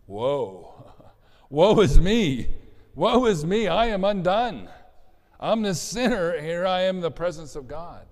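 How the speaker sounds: loud, high dramatic lament; woeful cry. Acted. Preacher acting out Isaiah before God, loud woeful cry. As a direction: cry out as an overwhelmed, undone sinner. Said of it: "you've got that sense of woe".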